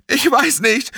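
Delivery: very high, distraught answer; panicked. Acted. Panisch beim leblosen Freund. (panisch und hilflos)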